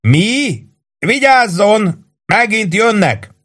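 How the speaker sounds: very high, slow warning shout; alarmed shout. A narrator's reading. Sergeant shouting a warning as planes return. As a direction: warn them as the planes come back.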